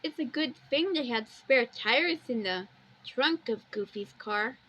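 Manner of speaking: ordinary talk, nothing marked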